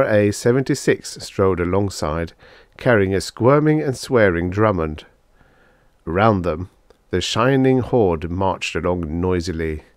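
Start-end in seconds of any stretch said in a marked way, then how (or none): none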